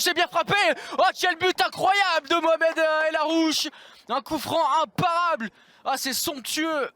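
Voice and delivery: high, amazed goal call; excited goal call. Real, not acted. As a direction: marvel at the free kick. Free kick goal called.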